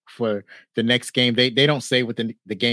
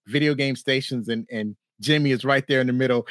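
ordinary delivery

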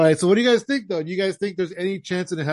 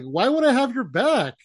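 high-pitched, protesting imitation; mock indignation; 6 semitones higher than usual. Elias answering Kevin Owens backstage, mock-indignant protest. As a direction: play up the wronged brother's complaint. Acting out wrestler's indignant refusal.

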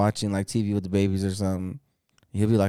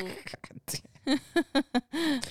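retold exasperation; 5 dB quieter than usual. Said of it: "I was like".

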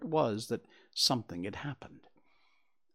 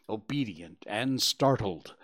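ordinary delivery

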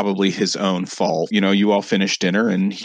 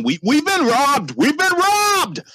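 furious screaming. Acted. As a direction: scream the alarm at the house. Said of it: "is screaming".

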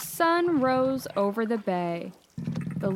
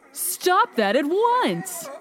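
high, slow indignant order; indignant scolding; 7 semitones higher, slower than usual. Acted. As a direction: scold the pesky seals, drawing it out. Lighthouse scolds teasing seals.

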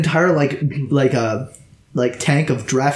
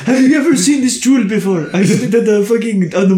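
high boastful NPC voice; 8 semitones higher, 3 dB louder than usual. Acted. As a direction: pitch it up and gloat.

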